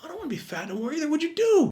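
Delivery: high, fast pleading whine. Retold or imitated. Fat kid begging his buff friend for tips. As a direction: beg him to share the secret. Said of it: "I'm trying, my friend".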